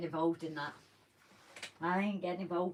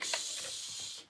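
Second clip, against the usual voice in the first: shushing; 8 dB quieter than usual. Pretend. Secret listening at work.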